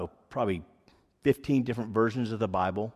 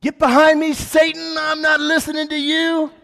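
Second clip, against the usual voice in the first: high, loud mock rebuke; rebuking shout; 12 dB louder than usual. To the voice he thinks is Satan, a high loud rebuke.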